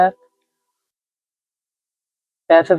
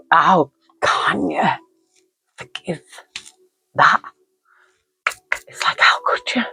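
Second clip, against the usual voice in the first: a hushed, unvoiced how could you; a real whisper of disbelief. Real, not acted. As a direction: whisper it as if it hurts to ask. Anguish over forgiving a killer.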